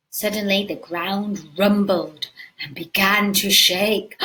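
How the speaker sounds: normal talking voice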